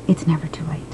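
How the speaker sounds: soft whispered reassurance; hushed, intimate reminder. Real, not acted. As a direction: whisper it like a secret promise. Closing reassurance to viewers.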